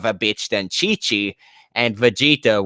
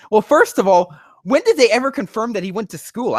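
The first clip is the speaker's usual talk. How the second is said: exasperated; 11 semitones higher, 3 dB louder than usual. Real, not acted. Heated rant.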